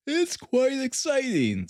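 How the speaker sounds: sarcastically bored, mid-yawn. A narrator's reading. Holmes to Gregson, bored and yawning. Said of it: "said Sherlock Holmes with a yawn".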